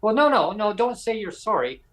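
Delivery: retold boss emphatically correcting; mock firm correction. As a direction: cut him off with an emphatic no. Horgan correcting Brent, quoted.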